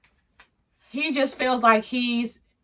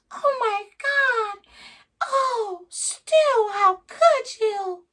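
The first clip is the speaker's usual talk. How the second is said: much higher, slow whiny mock of her; mocking upset girlfriend; 13 semitones higher, much slower than usual. Girlfriend scolding Stu for telling the truth, mocking high whine. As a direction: squeak it out like a hurt, dramatic girlfriend. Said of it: "she's like".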